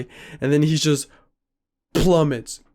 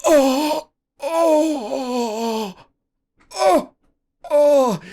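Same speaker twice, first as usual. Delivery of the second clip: high, drawn-out mock moans; imitated moaning; 11 semitones higher, much slower than usual. Imitating the winded friend being laid down, high moaning. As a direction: moan like someone with the air knocked out. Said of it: "He's just moaning".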